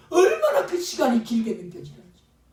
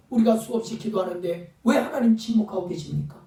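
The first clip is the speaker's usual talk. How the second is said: ordinary talk, nothing marked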